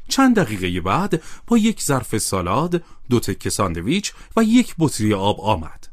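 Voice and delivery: ordinary delivery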